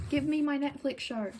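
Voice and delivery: normal talking voice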